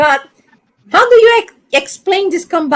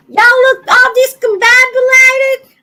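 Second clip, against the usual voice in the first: Y'all look all discombobulated, higher, hammed-up Southern twang; mock Southern; 11 semitones higher, 4 dB louder than usual. Brazilian woman imitating Southerners, mock Southern accent. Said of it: "I learned this word here in the South".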